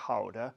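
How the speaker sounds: Gouda as "HOW-da"; Dutch pronunciation. Shown as a demonstration. Telling of the canal trip, Gouda said the Dutch way. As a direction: say Gouda with a soft H at the start. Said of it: "as the Dutch say".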